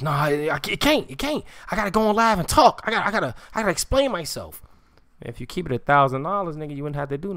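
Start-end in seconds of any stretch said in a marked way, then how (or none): 0.0-4.6 high, frantic mock pleading, stumbling over repeats; mock agitated protest. Mocking rivals who must go live to explain themselves, high frantic protest. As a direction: imitate a worked-up man insisting he must speak.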